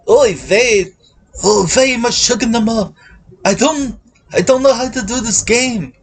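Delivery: a hint of an old Yiddish accent.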